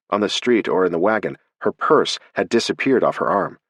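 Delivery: ordinary delivery, nothing marked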